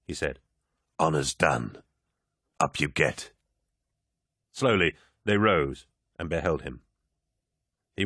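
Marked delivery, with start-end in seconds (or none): 1.0-3.3 low, smooth and commanding; commanding warrior lord. Acted. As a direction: speak like a genial, mighty lord. Narrator voices Horus.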